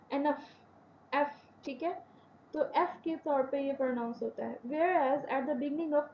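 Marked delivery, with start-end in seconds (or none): 0.1-0.4 "enough" with gh pronounced f. Shown as a demonstration. Teacher repeating the example for students, "enough" ending in F. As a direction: end "enough" on an F sound.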